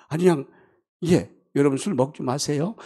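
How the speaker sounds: normal talking voice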